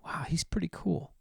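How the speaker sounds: breathy whispered awe; impressed inner thought. Acted. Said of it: "what's happens in their head".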